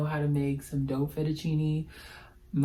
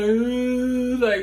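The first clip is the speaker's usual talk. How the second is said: breathy, higher gasp of delight; excited gasp; 8 semitones higher, 5 dB louder than usual. Retold or imitated. Fan alone in her room, stunned, excited gasp. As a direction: catch your breath in disbelief. Reliving idol's birthday wish.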